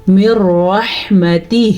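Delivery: slow model reading of مر رحمتہ for repeating; clear ha sounds. Shown as a demonstration. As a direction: stretch the last "hee" of مر رحمتہ. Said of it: "میرے ساتھ ساتھ پڑھیں".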